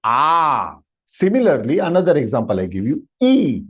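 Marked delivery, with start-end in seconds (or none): 0.0-0.7 A held long as a steady "aaah"; plutaha vowel. Shown as a demonstration. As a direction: draw the A out to three beats. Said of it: "Plutaha was interesting element".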